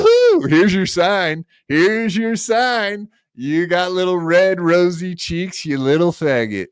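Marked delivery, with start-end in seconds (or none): 0.0-0.5 high, jeering woo-hoo; a high mocking cheer; gloating whoop. Real, not acted. 1.7-3.1 a slow, higher, sing-song "Here's your sign"; slow mocking drawl. Mocking the rival on stream.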